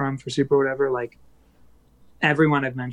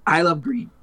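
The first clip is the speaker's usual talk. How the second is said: voice cracking; 3 semitones higher than usual. Real, not acted. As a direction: voice cracks on the words. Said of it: "Excuse me, my voice cracked".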